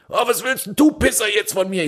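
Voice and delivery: high, rough mock yob snarl; aggressive guy. Driver imitates the angry car driver insulting him. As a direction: sneer it like a hothead picking a fight. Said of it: "den L-K-W-Fahrer als weiter anbrüllte".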